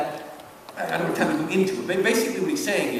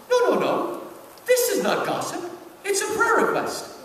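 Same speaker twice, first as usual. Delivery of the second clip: high, hammed-up sanctimonious voice insisting it's fine; mocking self-justifying churchgoer, much higher; mock pious gossip; 12 semitones higher than usual. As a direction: squeak out the excuse like a holier-than-thou church lady.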